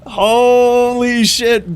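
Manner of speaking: high, slow, stretched holy shit; drawn-out amazed shout; excited shout. Real, not acted. Reacting to friend's message.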